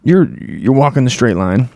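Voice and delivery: normal talking voice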